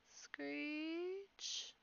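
high, soft rising screech; drawn-out screech. A narrator's reading. Metal rider coming down the dark tunnel, eerie screech. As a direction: stretch the word like scraping metal, sliding upward.

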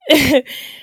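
brief amused chuckle; short laugh. Real, not acted. Amused before telling Moses argues again.

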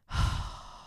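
long breathy exhale; weary sigh. Real, not acted. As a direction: let out a real, heavy sigh. Sighs over medication dilemma.

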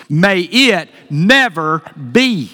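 slow, weighty, each word stressed; emphatic. Shown as a demonstration. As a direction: land it like a final verdict. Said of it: "An emphatic response".

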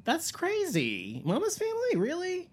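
surprised. Real, not acted. Shocked no DVDs exist.

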